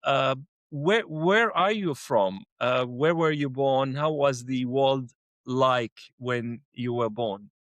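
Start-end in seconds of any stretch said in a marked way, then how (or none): none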